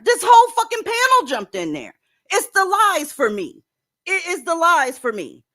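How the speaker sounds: rant, exasperated and high. Real, not acted. Host exasperated at the panel.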